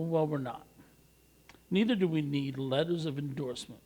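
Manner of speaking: ordinary delivery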